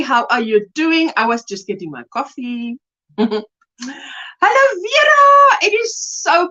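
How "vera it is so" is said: high, loud, delighted call of a name; excited greeting. Real, not acted. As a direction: greet her with a burst of joy.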